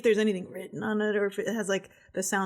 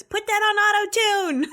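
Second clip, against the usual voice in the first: high, louder call; excited high shout; 12 semitones higher, 5 dB louder than usual. Acted. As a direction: shout it gleefully. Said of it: "Zink turns to Doug".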